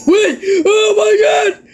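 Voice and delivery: very high screaming disbelief; shocked excited scream. Real, not acted. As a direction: shriek like you can't believe it. Another big surprise in game.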